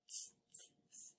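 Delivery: whispered teasing tss tss; monkeys' tss. Acted. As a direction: hiss like cheeky monkeys. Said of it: "the monkeys ... said".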